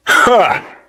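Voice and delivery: single high-pitched "ha"; sudden burst of delighted laughter; surprised laugh. Acted. Laughing at what the fans would think, surprised.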